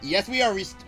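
ordinary talk, nothing marked

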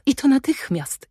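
put-on little girl who can't wait to try on a dress; excited little girl. A narrator's reading. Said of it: "zawołała".

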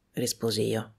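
ordinary delivery

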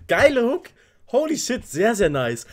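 loud, very high burst of praise; thrilled exclamation. Real, not acted. Reactor blown away by the hook, thrilled and loud.

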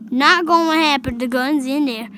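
ordinary talk

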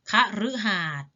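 คฤหาสน์ with ฤ said short "rue". Shown as a demonstration. Teacher shows pupils the reading, คฤหาสน์ with short "rue". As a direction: say คฤหาสน์ as kha-rue-hat. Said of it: "ออกเสียงรึ".

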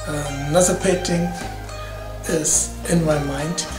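ordinary delivery

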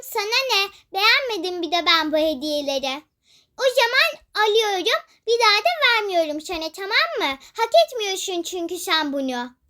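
ordinary talk

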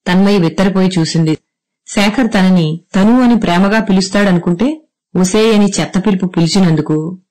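ordinary delivery, nothing marked

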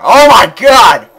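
loud, drawn-out shout of alarm; startled yell on seeing a mosh pit; shocked shout. Real, not acted. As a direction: yell it as the mob appears. Mob ambush outside.

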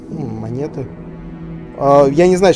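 usual speaking voice

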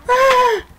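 piercing high shriek; panicked scream. Real, not acted. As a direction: scream as the hit lands. Scream of pain.

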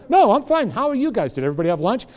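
high, breezy patient making light of it; joking. Retold or imitated. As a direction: chat brightly as if nothing is wrong. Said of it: "I'm cracking jokes".

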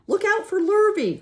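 very high, loud shout; called warning. A narrator's reading. Cow warning Wilbur of the hired man, high loud call. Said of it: "called the cow".

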